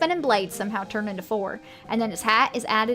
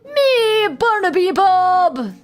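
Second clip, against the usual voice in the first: loud, much higher, slow showman cry; Barnaby Bob; 9 semitones higher, 9 dB louder, much slower than usual. Acted. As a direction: ringmaster shouting his own name grandly. Showman names himself.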